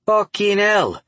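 stunned Cockney swear; Cockney accent. A narrator's reading. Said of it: "broad Cockney accent".

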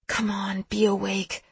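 soft, pleading whisper. A narrator's reading. Lex whispers into the phone, hoping Seth is awake. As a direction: whisper as if willing someone awake. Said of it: "I whisper".

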